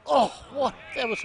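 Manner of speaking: loud, high shout of surprise; astonished outburst. Real, not acted. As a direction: react in real disbelief to the play. Commentator reacts to a play.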